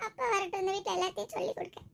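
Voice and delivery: put-on soft, high, sulky child; small, high crying-child grumble; tearful threat. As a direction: whimper it quietly through tears.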